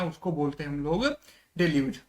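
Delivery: normal talking voice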